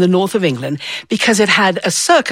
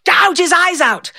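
mock yell; 9 semitones higher than usual. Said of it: "this one was yelling".

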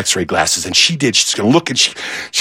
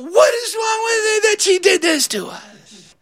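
high, pained inner wail; anguished cry. Retold or imitated. Kid despairing over gray peas, high anguished cry. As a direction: cry out in disbelief at the food.

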